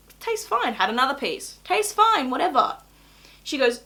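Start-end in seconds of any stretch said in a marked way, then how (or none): 0.1-0.7 breezy dismissive. Retold or imitated. Dismissive self-quote, high pitch. 1.6-2.8 breezy dismissive. Retold or imitated. Dismissive self-quote.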